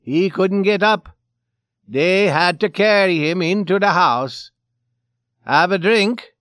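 big loud character voice; boastful Swede. A narrator's reading. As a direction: brag loudly, pushing a drink on them. Drunk Swede boasts, offers drink.

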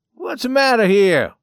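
high, loud demand; surprised. Acted. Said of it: "He paused in surprise".

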